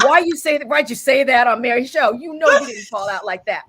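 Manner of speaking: loud mock scolding from her friends, laughing through it; teasing girlfriends. Girlfriends teasing her on the show, mock scolding. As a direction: tease her for overdoing it. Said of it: "They're like".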